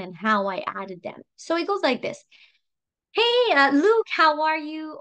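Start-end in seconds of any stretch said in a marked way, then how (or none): 3.1-5.0 high, loud hello easing down; bubbly greeting. Shown as a demonstration. Audio message to a Facebook friend, bubbly greeting. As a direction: open brightly, as if thrilled to reconnect.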